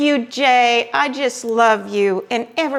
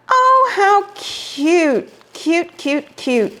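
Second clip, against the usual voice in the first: slow, much higher, drawn-out coo; 5 semitones higher, slower than usual. Real, not acted. Vlogger cooing at handmade ornaments from her friend, slow and high. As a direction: melt over something adorable.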